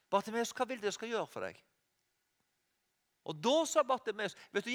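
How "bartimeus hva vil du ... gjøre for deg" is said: softer, quick and tender; soft gentle question. Retold or imitated. Jesus gently asking the blind man, soft and respectful. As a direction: ask it quietly, without pressure. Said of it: "Allikevel spør Jesus".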